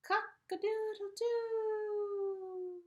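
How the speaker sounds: long, very high, loud crowing; drawn-out rooster call, high and loud. Acted. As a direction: stretch it out like a real rooster. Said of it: "they heard".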